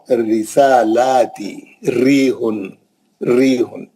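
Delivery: light ra recitation; thin ra demo. Shown as a demonstration. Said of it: "جب بھی را پہ زیر آئے گی".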